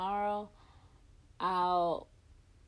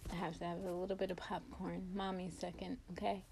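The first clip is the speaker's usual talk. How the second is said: soft plea; 10 dB quieter, much faster than usual. Retold or imitated. Asking her family for a moment, a soft hurried plea. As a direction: murmur a quick plea. Begging a moment during labor.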